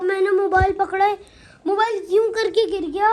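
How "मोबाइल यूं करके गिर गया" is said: ordinary talk, nothing marked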